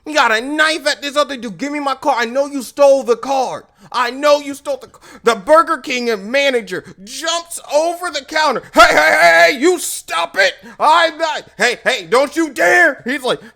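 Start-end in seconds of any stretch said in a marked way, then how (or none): 1.6-5.2 heated, high-pitched accusation; mock angry demand; mad. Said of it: "He's mad". 8.7-13.0 loud, high-pitched intervention; urgent mock manager yell; alarmed shout. Manager leaps in to stop fight.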